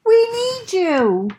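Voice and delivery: high, loud, slow plea; eager call. Acted. Bear calling Franklin to join the knights, high and loud. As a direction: call him over.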